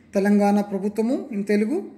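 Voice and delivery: normal talking voice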